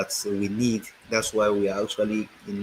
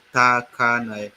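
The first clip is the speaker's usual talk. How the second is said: Takanai stretched syllable by syllable, louder; each syllable of Takanai held long; slow sounding-out; 2 semitones higher, 5 dB louder, slower than usual. Shown as a demonstration. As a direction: say Takanai slowly and firmly for learners. Sounding out the town name.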